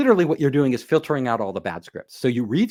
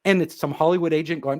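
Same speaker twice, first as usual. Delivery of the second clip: ordinary delivery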